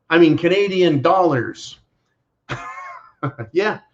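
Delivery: ordinary delivery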